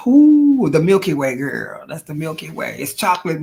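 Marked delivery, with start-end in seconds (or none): 0.1-1.9 excited ooh. Real, not acted. Excited at space backdrop.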